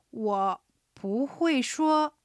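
不会说 drawn out very slowly; slow chunk practice. Shown as a demonstration. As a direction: stretch 不会说 syllable by syllable. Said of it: "連続して発音してみる".

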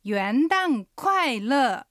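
very slow, each syllable drawn out; slow model pronunciation. Shown as a demonstration. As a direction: model it slowly for learners to repeat. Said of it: "発音と合わせて言ってみましょう". (一音ずつゆっくり手本の発音)

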